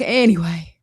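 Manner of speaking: a hint of a dramatic mom saying Anyway; lightly voiced theatrical mother, Anyway; mom impersonation. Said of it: "that voice is me impersonating my mom".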